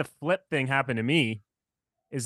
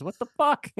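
much higher mock outrage; incredulous quote; 10 semitones higher, slower than usual. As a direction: fling it out in disbelief. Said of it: "and it's like".

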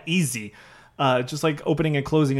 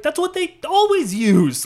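much higher and louder, leaning on always; know-it-all quote; 11 semitones higher, 4 dB louder than usual. Retold or imitated. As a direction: say it like everyone should know this. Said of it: "And he's like".

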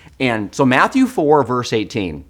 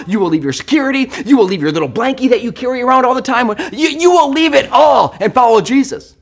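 higher, louder, building lecture; 8 semitones higher, 6 dB louder than usual. Retold or imitated. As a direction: pile on the demands.

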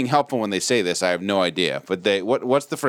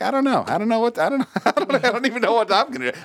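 mock panic; 10 semitones higher, faster than usual. Acted panic as things get broken.

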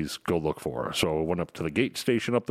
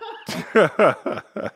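high laugh; 11 semitones higher, 6 dB louder than usual. Real, not acted. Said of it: "You badly right now".

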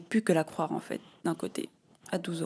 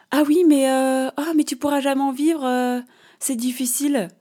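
higher, louder mock of a know-it-all girl; 6 semitones higher, 3 dB louder than usual. Mocking the classmate who said she can't live from it, higher and louder. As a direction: imitate a girl lecturing her, a bit hammed-up. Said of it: "Elle me sort".

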